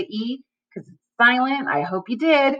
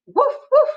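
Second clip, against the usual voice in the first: high, louder puppy bark; playful high woof; 5 dB louder than usual. Pretend. Teacher voices the little dog in the story. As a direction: yip like a tiny dog.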